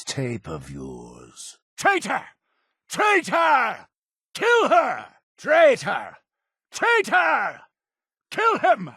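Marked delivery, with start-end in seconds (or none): 1.8-8.9 high-pitched accusing cries; furious denunciation. Acted. Denouncing a traitor and calling for death, high furious cries. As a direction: cry it out to rouse a mob.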